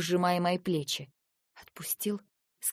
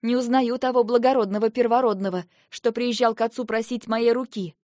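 higher, louder reproach; bitter reproach; 4 semitones higher, 6 dB louder than usual. Acted. Wife reproaches husband he has changed, loud. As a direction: shame him with the past.

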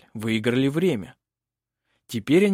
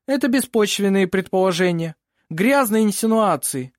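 loud, higher indignant protest; indignant objection; 7 semitones higher, 5 dB louder than usual. A narrator's reading. Opposing lawyer protests insinuations, indignant. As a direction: throw the accusation out.

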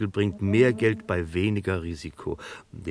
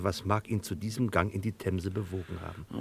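usual speaking voice

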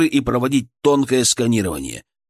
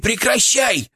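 Fyrk shouting; 11 semitones higher, slower than usual. A narrator's reading. Fyrk yelling inside the narrator's head, high and urgent. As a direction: cry out high in alarm. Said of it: "кричал Фырк в моей голове".

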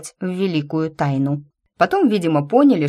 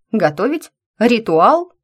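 rising, louder disbelief; surprised questions; 6 semitones higher, 5 dB louder than usual. Acted. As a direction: echo each word in surprise. Heroine caught off guard. (удивлённо переспросить)

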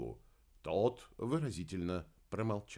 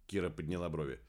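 ordinary delivery

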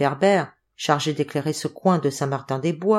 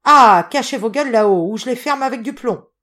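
raised, loud burst of a big rough villager's threat; booming threat; 6 semitones higher, 6 dB louder than usual. A narrator's reading. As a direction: burst out threatening the windows above. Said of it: "la grosse voix de Siméon qui éclatait dans la nuit". (menace tonitruante)

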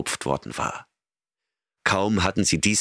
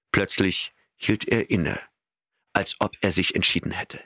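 usual speaking voice

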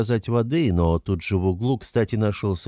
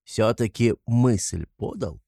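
sly, chuckling investigator; 3 semitones higher than usual. Acted. Investigator needling Raskolnikov about how suspects give themselves away, sly. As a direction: toy with the suspect, rambling and insinuating.

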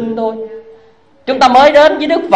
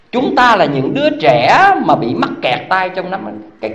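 usual speaking voice

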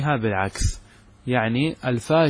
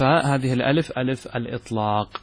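ordinary talk, nothing marked

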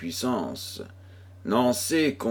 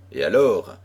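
ordinary delivery

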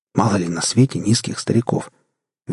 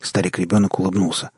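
ordinary delivery, nothing marked

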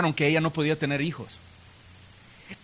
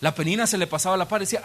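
usual speaking voice

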